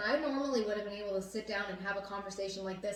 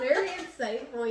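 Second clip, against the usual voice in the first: "Very insightful" repeated quicker through laughter, falling; laughing; 3 semitones higher, 5 dB louder than usual. Real, not acted. Still laughing at co-host's artsy line, amused. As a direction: laugh while saying "Very insightful" again. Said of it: "Ooh, look at that".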